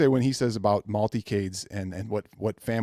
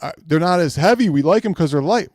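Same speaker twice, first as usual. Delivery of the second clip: mocking fans; 4 semitones higher, 5 dB louder, faster than usual. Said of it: "fans' excuses mocked".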